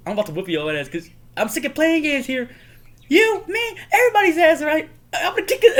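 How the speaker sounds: high comic bickering building to shouts; mock angry ranting. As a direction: build from exasperation to a hammed-up yell. Acted escalating comic quarrel.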